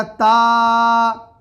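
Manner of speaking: ता with a long drawn ā; long ā demo. Shown as a demonstration. Teacher demonstrating standing zabar on ता. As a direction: hold the ā in ता. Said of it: "तो पढ़ा जाएगा".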